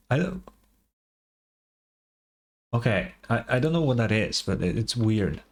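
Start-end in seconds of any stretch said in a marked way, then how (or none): none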